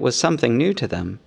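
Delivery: usual speaking voice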